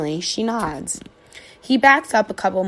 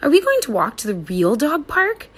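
hopeful eager dog; 7 semitones higher than usual. A narrator's reading. Dog hoping.

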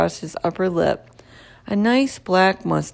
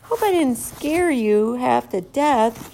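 slow, amused man's voice; a janitor gently teasing a hamster; jolly janitor; 5 semitones higher, slower than usual. A narrator's reading. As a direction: tease the hamster kindly, slowly. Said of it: "he said with a laugh".

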